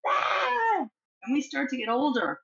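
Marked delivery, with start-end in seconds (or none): 0.1-0.9 put-on high kid's yell of release; playground scream. Shown as a demonstration. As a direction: let out a high playground shout. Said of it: "what do we do".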